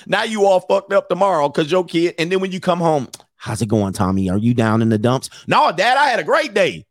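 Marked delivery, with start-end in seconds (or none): 3.4-5.3 low, gentle dad check-in; dad voice, pitched down; concerned dad. Acted. Dad checking on glum son. 5.5-6.8 put-on high, bouncy child's voice; cheerful kid. Son answers dad cheerfully.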